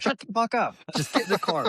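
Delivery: high-pitched, strained scolding; mortified hushing. Retold or imitated. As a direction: play the embarrassed dad silencing his child. Dad hushing his kid again.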